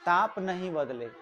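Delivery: ordinary talk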